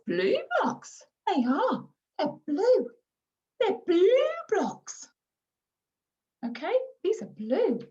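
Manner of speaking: put-on high, slow sing-song for a small child; repeating, reinforcing. Shown as a demonstration. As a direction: repeat the key word in a high, stretched lilt. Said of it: "repeating and reinforcing the key language".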